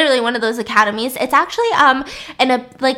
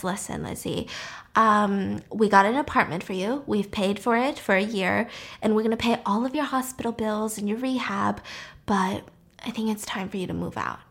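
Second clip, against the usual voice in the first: soft, reluctant delivery, fading quieter; gently breaking bad news; 6 dB quieter than usual. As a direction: let someone down gently, voice dropping. Anton gently asks her to leave.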